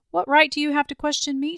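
a wronged lady snapping back in anger; indignant outburst. A narrator's reading. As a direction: fling the question back at him. Lady defies accusing lover.

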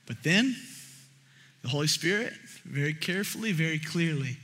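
soft, low, deliberate preaching; hushed reverence. Real, not acted. Preacher introducing what the Holy Spirit said, hushed. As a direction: drop low and soft to set up the message.

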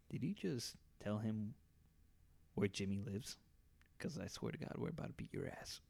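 quiet, menacing warning to a friend; low menacing threat. Retold or imitated. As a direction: lean in and threaten under your breath. Threatening Mister Late, retold.

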